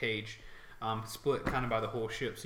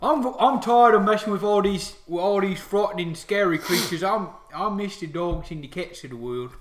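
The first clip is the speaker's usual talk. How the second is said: loud, raised orc voice; orc soldier; 7 semitones higher, 10 dB louder than usual. Acted. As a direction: confide heartily as a dog-loving orc. Orc guard talks while petting dog.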